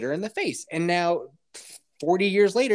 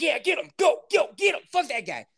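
egging-on dog voice; 11 semitones higher than usual. Retold or imitated.

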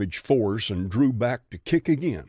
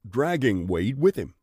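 usual speaking voice

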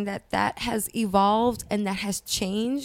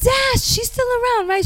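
surprised; 13 semitones higher, 6 dB louder than usual. Real, not acted. Surprised about Mrs. Dash.

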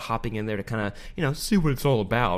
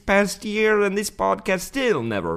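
higher-pitched, put-on Australian accent; 4 semitones higher than usual. Shown as a demonstration. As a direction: read it in a wandering mock Australian accent. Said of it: "that was actually his Australian accent the whole way through".

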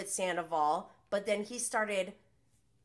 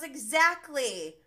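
smug mock; 5 semitones higher, 7 dB louder, slower than usual. Mocking James's smug retort.

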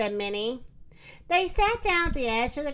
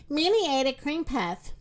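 usual speaking voice

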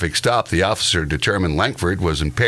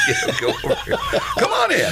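laughing. Pretend. Acted landlord inviting tenant in.

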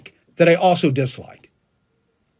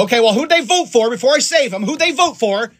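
high-pitched mock interrogation; mock demanding; 7 semitones higher, 3 dB louder than usual. Bystander at a burning car checking votes, mock demanding. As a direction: demand it like an absurdly picky rescuer. Acts out absurd rescuer's demand.

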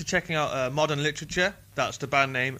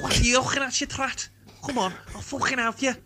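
put-on much higher, louder, phlegmy Northern accent; 12 semitones higher, 3 dB louder than usual. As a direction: bark it in a rough Northern accent. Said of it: "it's phlegmier".

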